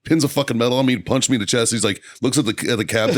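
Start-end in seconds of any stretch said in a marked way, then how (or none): none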